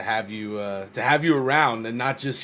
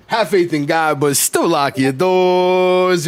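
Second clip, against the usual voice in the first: loud, raised sign-off with a long drawn-out DOORS; sign-off catchphrase; 5 semitones higher, 7 dB louder, slower than usual. Real, not acted. Host signing off to the crowd, loud drawn-out catchphrase. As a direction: belt out the catchphrase, stretching the last word.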